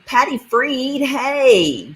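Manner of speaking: high, loud happy hello; excited greeting. Real, not acted. Streamer welcomes viewer Patty into the stream, high excited hello. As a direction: greet her joyfully by name.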